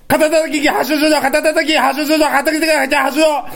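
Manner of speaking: rapid tongue twister. Shown as a demonstration. Challenger takes up the three-times dare, rapid tongue twister. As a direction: race through it without a break. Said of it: "早口だから早く喋らないとダメだな".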